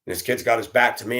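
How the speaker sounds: ordinary delivery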